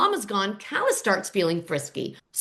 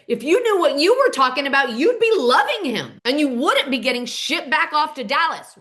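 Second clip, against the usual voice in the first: high, loud retold outburst; losing it; 5 semitones higher, 4 dB louder than usual. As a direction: lash out as if losing your temper. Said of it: "Cole loses it and says".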